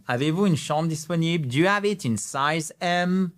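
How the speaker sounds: ordinary talk, nothing marked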